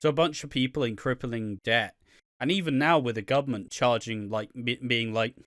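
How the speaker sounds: normal talking voice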